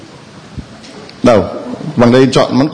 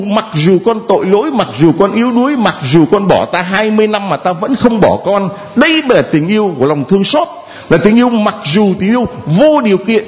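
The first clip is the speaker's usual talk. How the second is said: raised, fervent sermon voice from the pulpit; preaching; 7 semitones higher than usual. Acted. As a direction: preach it out to the whole church. Priest preaching to congregation. (giọng giảng đạo nhiệt thành)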